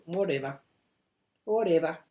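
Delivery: offhand falling brush-off; dismissive. Retold or imitated. Dismissive reply in retold row.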